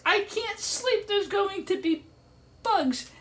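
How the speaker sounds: mother's whine. Retold or imitated. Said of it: "she's like".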